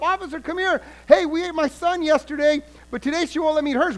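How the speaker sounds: high, loud call to an officer; yelling at the cop. Retold or imitated. Woman calls out to an officer on the street, yelling. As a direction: shout across the street.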